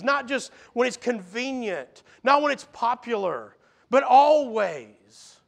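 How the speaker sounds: high, forceful sermon delivery; fervent preacher in full flow; impassioned preaching. Acted.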